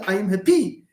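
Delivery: "happy" mispronounced as hap-PY, end stressed; misplaced final stress. Acted. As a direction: act out a student saying "happy" as hap-PY. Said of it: "since you pronounce happy as happy".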